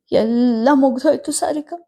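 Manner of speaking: mother breaking down, leaning on ಮುಗ್ದೋಯ್ತು; grieving, lightly voiced old mother; tearful mother. A narrator's reading. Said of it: "ಕಣ್ಣೀರು ಗರೆದರು".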